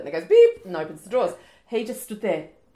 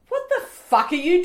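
mock baffled; 11 semitones higher, 5 dB louder than usual. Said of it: "I'm, like, going".

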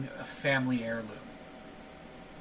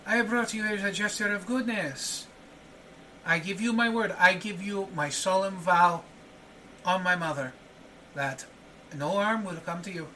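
higher, earnest solemn promise; reassuring host; 5 semitones higher than usual. Acted. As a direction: swear the oath to calm him. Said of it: "He goes".

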